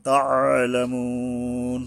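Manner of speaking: slow তাআলামুন with "moo" held three beats; three-alif stretch. Shown as a demonstration. Teacher shows stopping, তাআলামুন with long ending. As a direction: sustain the last vowel of তাআলামুন, then stop. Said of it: "তিন আলিফ টাইনা থামতে হবে".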